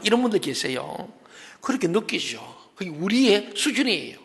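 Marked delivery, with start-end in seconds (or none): none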